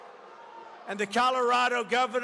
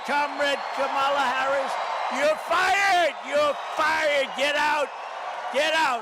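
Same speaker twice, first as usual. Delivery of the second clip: louder and higher, yelling; rally-stage shouted dismissal; furious shout; 4 semitones higher, 6 dB louder than usual. Real, not acted. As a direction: bark the firing at the crowd. Rally crowd, firing his rival.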